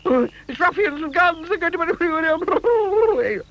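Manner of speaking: high frantic shrieking; panicked. A narrator's reading. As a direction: sure ruffians have him. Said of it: "he screamed".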